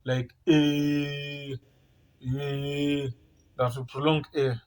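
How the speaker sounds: A drawn out long; A held long and even. Shown as a demonstration. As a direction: prolong the A sound. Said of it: "that long A".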